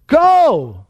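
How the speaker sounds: high, punched imperative; a commanding, drawn-out "go"; emphatic command. Retold or imitated. Preacher voicing Christ's command to the church, emphatic.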